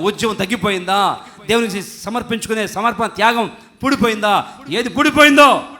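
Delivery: volley of questions building to a loud peak; impassioned challenge. Real, not acted. Preacher asks the congregation what has dried up, impassioned challenge.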